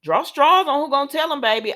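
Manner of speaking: high and loud teasing call; excited quip. Retold or imitated. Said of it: "I said".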